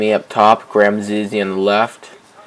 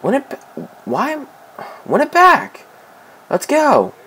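high-pitched, broken-off urging; frantic urging. Real, not acted. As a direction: urge the team on, voice pitched way up. Urging teammate to recover ball.

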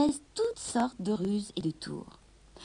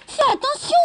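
high loud alarmed cry; 7 dB louder than usual. A narrator's reading. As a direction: shout a warning. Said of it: "s'écria Gobelino".

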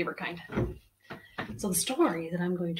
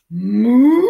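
long, loud rising moo; cow moo; 2 semitones higher, 15 dB louder than usual. Acted. George the pup answering his mother, loud cow moo. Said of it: "And George went".

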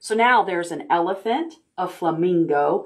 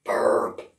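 a breathy fake little burp; pretend burp. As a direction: play a silly burp.

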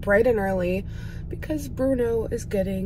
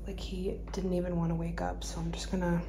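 whispered, much softer narration; whispering; 13 dB quieter than usual. Real, not acted. Owner whispering beside her sleeping puppy. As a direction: hush so the sleeping dog stays asleep. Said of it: "he's so sleepy".

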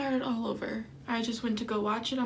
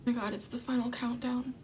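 usual speaking voice

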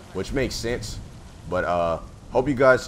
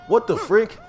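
surprised shout; 12 semitones higher, 4 dB louder, slower than usual. Real, not acted. Sudden weird event in game.

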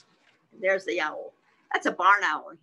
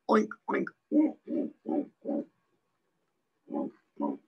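repeated short pig grunts; pig oinking. Acted. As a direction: oink and snort like a pig. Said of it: "A pig says oink".

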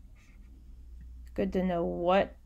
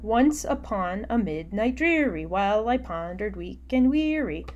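lilting sing-song meter, each beat bounced; sing-songy reading; 2 semitones higher, 5 dB louder than usual. Shown as a demonstration. Teacher showing the class the bouncy meter of The Raven. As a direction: read it like a nursery rhyme, bouncing the rhythm. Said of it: "notice I'm reading it, like, kind of sing-songy".

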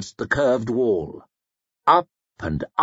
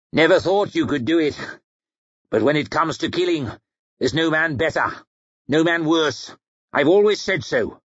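quick, taunting speech from a rival; 2 semitones higher, faster than usual. A narrator's reading. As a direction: needle him briskly from up on the walls. Bethod taunts Logan from above.

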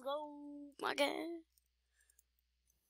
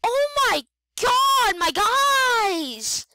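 high, loud frightened yelling; scared shout; 9 semitones higher, 8 dB louder, much slower than usual. Real, not acted. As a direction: shout it, still shaking from the scare. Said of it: "That scared the living crap out of me".

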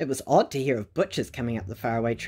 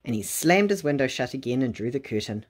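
ordinary delivery

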